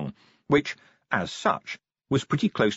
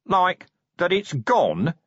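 nasal corporal voice; 7 semitones higher, slower than usual. Acted. Nobby voiced in read story.

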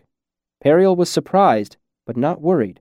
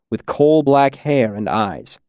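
ordinary delivery

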